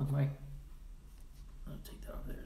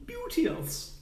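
Beauteous! exclaimed much higher in a put-on voice; exclaimed word. Shown as a demonstration. Savouring the word Beauteous! for viewers, high put-on voice. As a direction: sing out Beauteous! in a falsetto flourish. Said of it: "That's a word people don't use enough".

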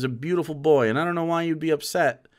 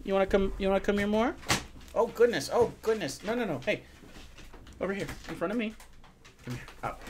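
higher, softer cooing to a dog; coaxing a pet; 4 semitones higher, 7 dB quieter, faster than usual. Real, not acted. Owner gets the dog to come up front, coaxing softly. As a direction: coax the dog gently in a raised little voice.

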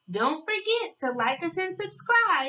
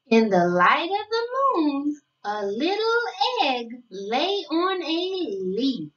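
storybook reading; 3 semitones lower than usual. Acted. Narrator reading a picture book to young children, sing-song storytelling. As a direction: read it like a bedtime picture book, savoring each day.

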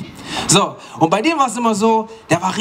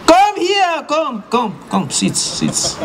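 mother's voice; 9 semitones higher, much slower than usual. Retold or imitated. Mum orders her son to sit down. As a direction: summon him to sit before trouble.

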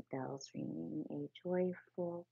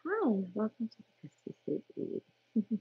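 higher, louder, hammed-up announcer; mock ad voice; 5 semitones higher, 5 dB louder than usual. Playful fake commercial voice. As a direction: narrator joking as a shop advert, announcer-style.

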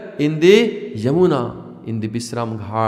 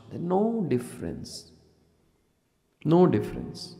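hushed emphasis; 3 semitones higher, 8 dB quieter than usual. Acted.

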